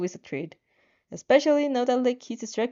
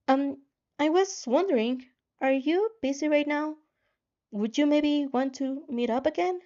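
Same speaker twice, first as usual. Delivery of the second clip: higher, brighter voice for Izuku; Izuku's message; 6 semitones higher than usual. Acted. Izuku asks to meet.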